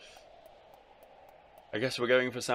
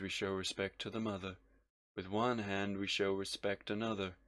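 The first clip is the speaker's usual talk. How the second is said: subdued, softer, solemn character voice; grave avenger; 3 semitones lower, 7 dB quieter than usual. Acted. Avenger confronting Elro over the past, quiet and grave. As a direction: confront them with quiet, weary gravity.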